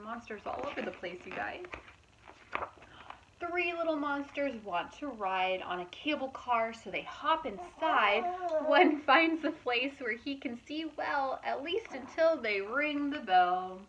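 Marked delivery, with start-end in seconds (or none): 3.4-13.8 lively, louder storybook narration; reading to children. A narrator's reading. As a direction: read it out brightly to a young audience. Reading picture book aloud.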